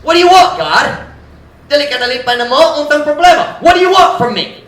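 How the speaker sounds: high, loud exasperated cry; mocking exasperation. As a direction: throw the question at God in mock frustration. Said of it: "They're mocking".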